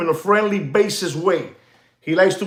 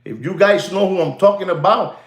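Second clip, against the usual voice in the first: ordinary talk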